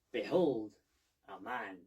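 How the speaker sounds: loud, theatrical "behold"; loud mock-Diogenes unveiling; grand proclamation. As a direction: unveil it like a showman. Diogenes parody presentation.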